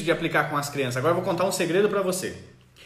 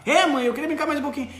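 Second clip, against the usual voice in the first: put-on higher, sweet kid voice; little child; 8 semitones higher, 3 dB louder than usual. Child telling mother he wants more play, kid voice. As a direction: play the child answering mom.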